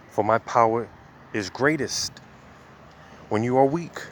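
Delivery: deliberate preacher's scripture read; slow scripture reading. Acted. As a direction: lean into each phrase of the verse slowly. Reads scripture slowly.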